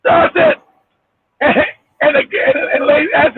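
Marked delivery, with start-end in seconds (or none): none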